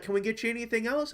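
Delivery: hint of a perky waitress; imitating the waitress. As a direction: rattle it off like a cheery waitress. Waitress voiced in story.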